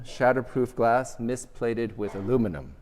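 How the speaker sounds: ordinary talk, nothing marked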